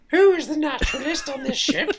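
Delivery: put-on high, loud old-timey Queen voice; 1950s narrator. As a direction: play a haughty 1830s Queen. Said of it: "your eighteen thirties voice".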